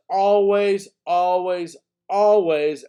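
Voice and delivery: loud, drawn-out ALWAYS each time; emphatic repetition. Real, not acted. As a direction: hammer "always", slow and separate. Drumming in a key rule.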